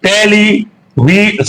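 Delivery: टेलीवी- slowly in separate parts, cut off unfinished; slow, segmented टेलीवी-; split into syllables. Shown as a demonstration. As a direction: break टेलीवी- into slow pieces and stop short. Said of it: "pronounced in four attempts".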